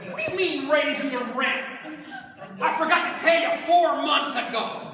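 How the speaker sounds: loud, high preacher cadence; fired-up pulpit delivery; preaching. Acted. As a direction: hammer it home to the congregation. Preaching the point at full force.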